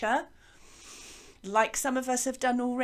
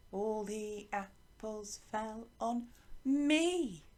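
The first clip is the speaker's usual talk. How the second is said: slow, softer chant stretching "me"; teacher modelling rhythm, long final "me"; long sound on me; 5 dB quieter, much slower than usual. Shown as a demonstration. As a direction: chant it slowly, holding "me" long at the end. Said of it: "Can you hear that there's a pattern".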